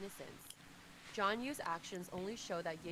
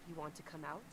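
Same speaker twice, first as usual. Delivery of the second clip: cold; 3 semitones lower, 4 dB quieter than usual. Acted. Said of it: "says coldly".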